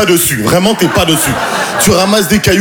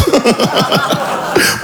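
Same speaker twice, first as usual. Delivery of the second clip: airy laugh; 8 semitones higher than usual. Real, not acted. Comedian confessing he was a gigolo, airy laugh. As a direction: laugh it off, embarrassed.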